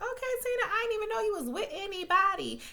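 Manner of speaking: high-pitched mock surprise, fast on the second line; sassy high voice, eyebrows up; sarcastic disbelief. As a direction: act fake-shocked at the news. Mocking reply to Tina's news.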